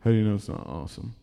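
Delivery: low, quick dopey mumble; imitating a dim friend; dumb friend. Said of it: "He's so dumb".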